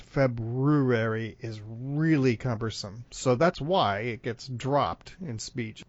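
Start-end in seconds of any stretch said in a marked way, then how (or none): 0.0-1.4 "February" slowly split as FEB-ROO-airy; slow right down and sound the BR in "February"; broken into syllables. Shown as a demonstration. Showing it is cumbersome, "February" slowly as FEB-ROO-airy. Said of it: "To actually break it".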